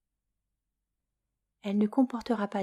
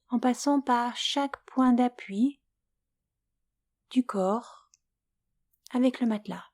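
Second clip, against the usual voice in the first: guided Yoga Nidra; 2 semitones higher than usual. Acted. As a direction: lull the listener toward sleep, unhurried and hushed at times. Leads Yoga Nidra for sleep.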